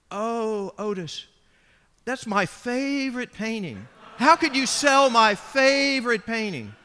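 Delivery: a wife's wounded protest, building in pitch and volume; upset wife Velma. Retold or imitated.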